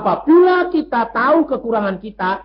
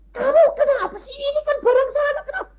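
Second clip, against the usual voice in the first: mock annoyed husband; faster than usual. Husband scolding over phone call.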